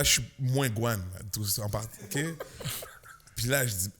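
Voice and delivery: ordinary talk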